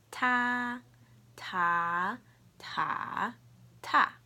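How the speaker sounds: loud "Ta" four ways: high level, rising, dipping, falling; four tones demo. Shown as a demonstration. Teacher modelling for learners, four tones on "Ta". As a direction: step "Ta" through the four Mandarin tones, last one short. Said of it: "I'll say the four tones".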